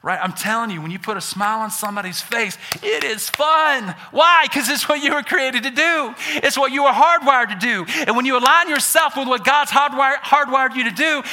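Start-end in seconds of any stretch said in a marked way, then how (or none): 2.8-11.2 preaching shout. Real, not acted. Pastor shouting to the church about doing good. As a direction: shout the joy of it.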